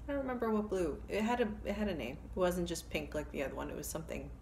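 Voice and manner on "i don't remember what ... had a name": ordinary talk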